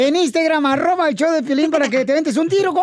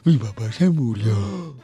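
put-on much lower, slow dopey boy; dopey kid voice; 12 semitones lower, slower than usual. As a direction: play the dim student, low and slow. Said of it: "Dice el Babalucas".

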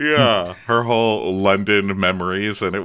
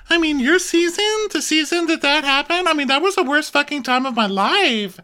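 high mock squeal; Brandy impression; 4 dB louder than usual. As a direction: squeal it in exaggerated outrage. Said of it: "Brandy squeals. That's how she talks".

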